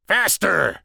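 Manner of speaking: high, loud barked order; commanding shout. A narrator's reading. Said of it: "the Sicilian commanded".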